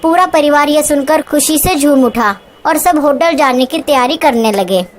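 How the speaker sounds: ordinary delivery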